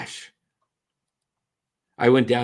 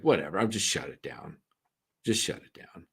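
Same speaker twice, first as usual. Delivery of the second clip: resigned; 7 semitones lower, 7 dB quieter than usual. Retold or imitated. Retelling giving up on the stream.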